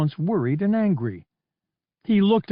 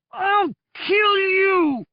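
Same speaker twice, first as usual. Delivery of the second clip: drawn-out howl, much higher and louder; furious scream; 4 dB louder, much slower than usual. Acted. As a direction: scream it with everything he has. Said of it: "The force of his scream".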